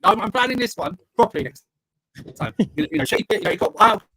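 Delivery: glitchy, cutting-out mic audio; scrambled voice. Real, not acted. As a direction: voice breaking up over a lagging connection. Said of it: "your mic is cutting out".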